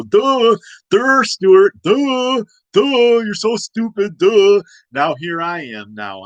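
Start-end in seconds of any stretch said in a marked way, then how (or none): 0.0-4.6 high, loud mocking "duh" chant; imitating school bullies. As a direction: sneer the way the popular kids did. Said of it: "make me feel stupid and go".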